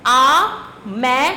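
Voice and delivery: usual speaking voice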